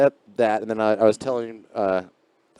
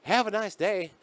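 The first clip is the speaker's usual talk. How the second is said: tweaker voice; 9 semitones higher, slower than usual. Retold or imitated. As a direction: mimic him, then blurt the curse. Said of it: "he goes".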